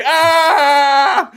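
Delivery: loud high scream of panic; terrified scream. Retold or imitated. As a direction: scream at the steamroller close up. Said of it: "and he's like".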